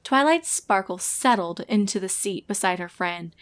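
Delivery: plain reading voice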